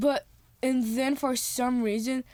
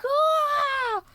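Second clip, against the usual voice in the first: high, loud held "ah"; acted screaming outburst; imitated explosion scream; 5 dB louder than usual. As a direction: shriek it like a pretend blast. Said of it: "It was like this".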